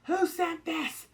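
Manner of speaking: high, loud angry mock demand; imitating a furious girl demanding answers; pissed demand. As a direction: fire off the question like she's furious. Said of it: "so she's pissed".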